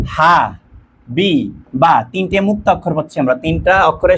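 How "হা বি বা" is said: "হা, বি, বা" as three separate beats; split into syllables. Shown as a demonstration. Teacher showing students the answer, "হা, বি, বা" syllable by syllable. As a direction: pause after each part of "হা, বি, বা". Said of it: "দেখো".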